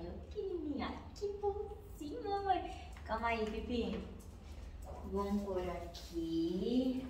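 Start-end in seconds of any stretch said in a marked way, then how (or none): 0.0-2.7 high, soft cooing to a tiny pet. Real, not acted. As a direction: pet owner cooing softly to her little dog. Cooing softly to small pet.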